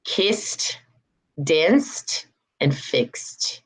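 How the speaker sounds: "kissed, danced, and fixed" each ending on T; final T sounds. Shown as a demonstration. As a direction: say "kissed" as kist, "fixed" as fikst. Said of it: "hear the T sounds".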